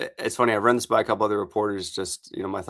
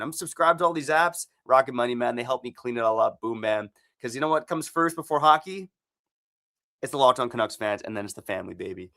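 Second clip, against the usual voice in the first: upbeat sponsor read, a touch higher; 4 semitones higher than usual. Acted. Host reading the Rocket Money spot to listeners, upbeat ad read. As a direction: sell it like a friendly podcast ad.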